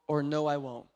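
ordinary talk, nothing marked

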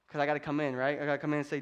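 ordinary talk

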